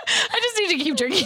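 quick, high, giggly aside; cracking up while speaking; laughing. Real, not acted. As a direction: talk fast through laughter. Cracking up after bad accent.

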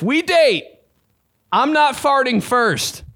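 high, slow, drawn-out declaration; dramatic vow. Acted. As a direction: stretch it like a solemn oath. Same vow again, higher.

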